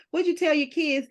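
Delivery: usual speaking voice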